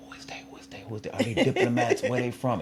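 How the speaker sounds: hushed crowd whisper, quick questions; murmured, overheard whispers; imitated whispering. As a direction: whisper it like curious onlookers passing a rumor. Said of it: "everybody is whispering".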